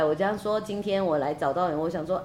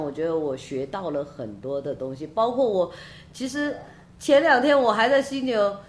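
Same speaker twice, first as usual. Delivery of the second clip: ordinary talk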